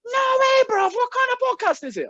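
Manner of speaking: very high, amazed mock salesman accent; Aaron's accent. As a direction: squeal it in an imitated salesman accent, loud at first. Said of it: "I'm not exaggerating this accent".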